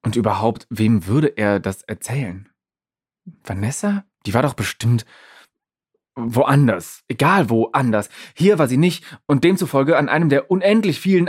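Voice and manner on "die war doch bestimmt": usual speaking voice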